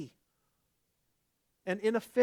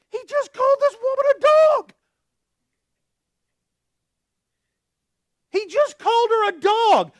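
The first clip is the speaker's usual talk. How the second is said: much higher, much louder outcry; shocked shout; 14 dB louder than usual. Real, not acted. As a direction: shout it in disbelief. Preacher shocked at Jesus' words.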